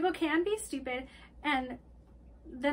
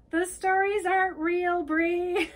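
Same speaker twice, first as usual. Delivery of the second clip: loud, raised retold yell; 6 semitones higher, 7 dB louder than usual. Diego calls down to Bree from the ceiling, acted yell. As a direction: call it down to someone below, exasperated. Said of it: "He just yells that down".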